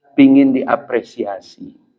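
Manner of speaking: usual speaking voice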